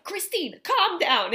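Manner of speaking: dad telling her to calm down. Retold or imitated. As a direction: imitate a dad scolding from the stands. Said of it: "your dad was like".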